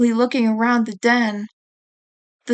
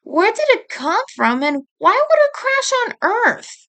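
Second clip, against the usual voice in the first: much higher, climbing bewilderment; baffled, asking why here; puzzled sister; 10 semitones higher than usual. A narrator's reading. Character questions rock's origin.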